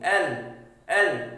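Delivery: एल with the tongue folded up; tongue placement demo. Shown as a demonstration. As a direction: sound out एल, tongue touching the top. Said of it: "वो fold हो गई".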